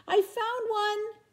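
very high, slow delighted squeal; excited cry. Acted. Katy finding an egg behind a rock, crying out excitedly. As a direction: cry out in triumph. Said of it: "cried Katy".

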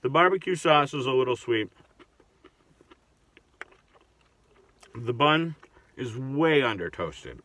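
chewing while talking; speaking around burger bite; mouth full. Real, not acted. Seen 1: Bite taken at -5.0 and again near 9.7; mouth mostly closed while talking.

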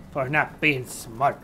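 ordinary delivery

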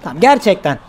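soft reassuring call to a teammate; sincere. Real, not acted. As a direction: drop the teasing and mean it. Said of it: "Gerçekten gel".

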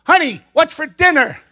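high, loud acted call. Husband testing wife's hearing from behind, loud high call. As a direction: call to someone across the room. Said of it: "He gets ten feet behind her".

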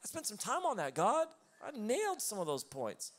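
high, loud mock outrage at God; indignant protest. Pastor retelling protest to God about his sermon, high and loud. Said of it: "I'm like".

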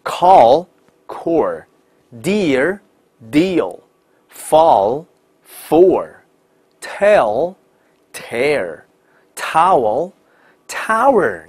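slow alternating words, dark L in Call, curled r in core; very slow spaced word list, each final L or r held; L versus R. Shown as a demonstration. Teacher drills the contrast for learners, slow and exaggerated. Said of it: "卷舌音区别大大的有".

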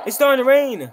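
loud announcement. Acted. NPC announces rain.

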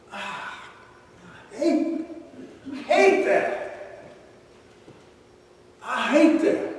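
high, whining "I hate that"; whining devil. Acted. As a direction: squeal like a sore loser devil. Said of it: "he's gonna go".

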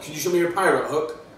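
usual speaking voice

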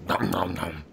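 low, soft munching noises; mock munching. Player pretending to eat a friend, mock munching. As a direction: make playful chomping sounds under the line.

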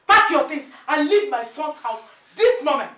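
loud and high, throwing her out; commanding shout. Acted. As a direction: order her out now. Ordering daughter-in-law out.

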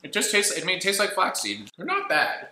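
eating a cracker; talking while chewing. Real, not acted. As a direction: mouth partly full. Seen 0: Both men eat crackers, hand near mouth; speaker unclear.

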